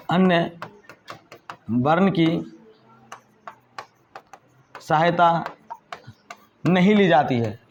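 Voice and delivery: teacher dictating word by word, long pauses between phrases. Shown as a demonstration. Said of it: "dictating a definition slowly".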